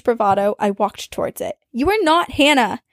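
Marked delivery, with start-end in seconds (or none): 1.7-2.8 raised, firm defiance; angry retort. Retold or imitated. To the possessed friend, angry confrontation. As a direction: stand up to the demon.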